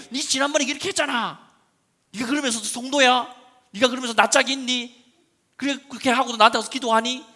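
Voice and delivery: high, loud sneering reproach; mock accusation. Imagined accuser mocking a believer, loud sneering reproach. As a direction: jeer at the sinner.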